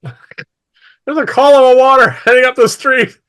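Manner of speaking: high, very loud lookout's shout; shouted alarm. Acted. Soldiers shouting orders across the street, high alarmed yell. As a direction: cry out the alarm at the top of your voice. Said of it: "shouts being given".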